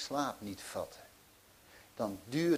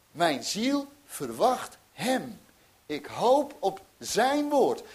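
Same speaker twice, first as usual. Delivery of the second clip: slow, higher and louder; fervent psalm recital; 7 semitones higher, 7 dB louder, slower than usual. Acted. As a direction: lift the words of hope. (vurig voorgedragen psalm, traag en luider)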